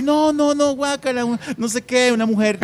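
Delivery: high, whiny protest of no, no, no; squeamish refusal. Retold or imitated. Willy recoiling from a coworker's touch, high whiny protest. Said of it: "Y Willy".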